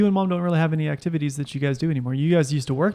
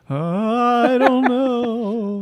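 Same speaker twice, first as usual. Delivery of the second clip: ghost voice; 7 semitones higher, much slower than usual. Pretend. Pretending to be the ghost.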